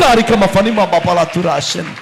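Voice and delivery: speaking in tongues. Acted. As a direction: let the syllables pour out in ecstasy.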